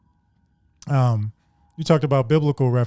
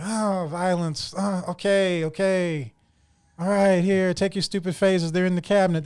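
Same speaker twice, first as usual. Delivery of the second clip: slow, soft, resigned sighing; weary sigh; 5 semitones higher, 4 dB quieter than usual. Retold or imitated. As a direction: give in like a tired parent. Organian sighs, gives phasers.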